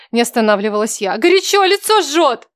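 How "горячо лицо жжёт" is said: high, loud, slow complaint; pained outcry. Acted. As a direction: cry out against the burning heat. Героиня обжигается паром. (болезненный вскрик)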